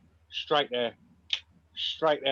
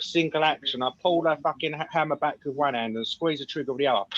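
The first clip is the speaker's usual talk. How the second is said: usual speaking voice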